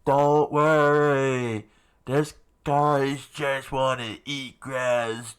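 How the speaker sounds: loud, slow carefree shout. Acted. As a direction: yell it out laid-back. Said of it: "screamed out".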